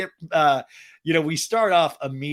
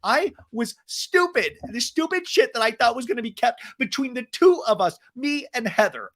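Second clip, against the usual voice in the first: mock Shannon; 9 semitones higher than usual. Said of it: "Shannon's like".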